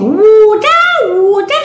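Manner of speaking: "water" high, with a long oo; child's put-on "water", mockingly stretched to "wooter"; affected "water". Auntie imitating that child, affected "water". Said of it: "that child was saying".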